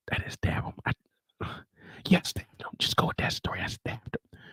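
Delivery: hushed unvoiced whisper. Drew on a burner phone in jail, whispering. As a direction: whisper so the guards can't hear. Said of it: "why are you whispering".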